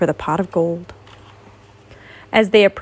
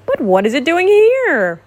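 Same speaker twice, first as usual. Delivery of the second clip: higher, grumpy demand; grumpy question; 6 semitones higher, 3 dB louder than usual. A narrator's reading. Owl questioning the honey pot, grumpily. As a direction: ask it crossly, pitch climbing. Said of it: "a grumpy owl asked".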